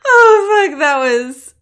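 high, loud ecstatic cries; climax cries. Acted. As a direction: let the climax break out in cries. Climax in the sex scene.

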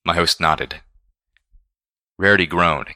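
usual speaking voice

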